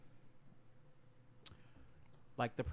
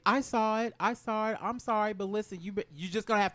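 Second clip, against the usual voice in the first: excited; 8 semitones higher, 6 dB louder than usual. Real, not acted. Excited outburst at table.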